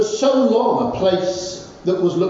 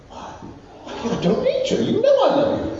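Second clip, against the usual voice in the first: dismissive husband; 13 semitones higher than usual. Retold or imitated. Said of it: "husband answers wife".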